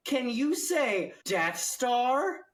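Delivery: put-on high, eager kids' show host; Dora the Explorer voice. Mocking the Star Wars plot, high eager Dora voice. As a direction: talk to the kids like a cartoon explorer. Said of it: "a Scooby-Doo or Dora the Explorer episode".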